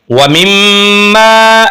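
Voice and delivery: मिम्मा held in the nose, the doubled म humming, slowly chanted; nasal ghunna. Shown as a demonstration. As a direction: hum the म of मिम्मा through the nose. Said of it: "एक अलिफ के बराबर खींचेंगे".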